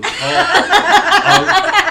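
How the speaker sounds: high squeaky laugh through words. Real, not acted. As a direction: giggle the words out slowly.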